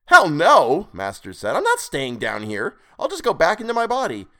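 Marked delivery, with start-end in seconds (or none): 0.0-0.9 high, loud, indignant refusal; defiant outburst. A narrator's reading. Captive refusing to stay below, defiant. 1.6-4.3 indignant, rising in pitch; defiant. A narrator's reading. Captive insisting he will return to his body, defiant.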